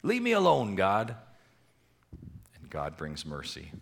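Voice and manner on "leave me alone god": high, slow, mock-defiant cry at God; hammed-up rebellious protest; defiant rebel. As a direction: shake your fist with the voice, dragging it out. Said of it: "shake our fist to God and say".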